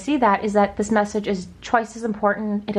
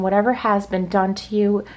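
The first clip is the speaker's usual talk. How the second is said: ordinary delivery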